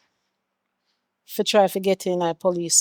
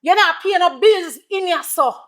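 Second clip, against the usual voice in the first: high-pitched exasperated motherly rant; berating; 5 semitones higher than usual. Acted. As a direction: tear into the idle son, pitch raised. Mother berates son about laziness.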